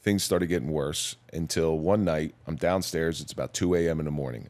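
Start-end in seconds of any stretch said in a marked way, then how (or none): none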